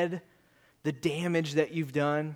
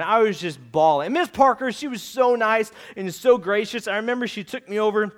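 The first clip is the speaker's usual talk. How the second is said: ordinary talk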